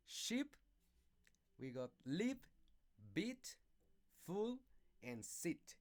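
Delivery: sheep, lip, beat, full, sit said as short-vowel examples; teacher reading a word list; short vowel demo. Shown as a demonstration. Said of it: "This column has the short vowel sound".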